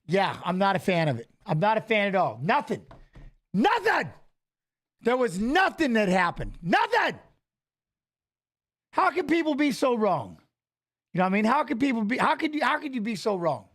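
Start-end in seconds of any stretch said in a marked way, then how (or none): 3.5-4.1 high, exasperated nothing; nothing happened, exasperated and high. Real, not acted. Exasperated at eclipse fearmongers. 6.6-10.4 high, exasperated nothing; nothing happened, exasperated and high. Real, not acted. Exasperated at eclipse fearmongers.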